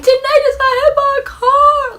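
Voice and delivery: high, crying alarm; imitating mom crying on phone. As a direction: blurt it out as a panicked mom. Mom phoning family, crying.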